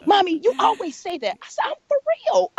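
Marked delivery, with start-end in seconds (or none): 0.0-1.4 higher, lightly teasing daughter; daughter's voice. Retold or imitated. Daughter teases her mother about Jesus, higher voice. 1.7-2.5 much higher, emphatic; mean every word; insisting. Retold or imitated. Mother insists to her daughter, much higher and emphatic.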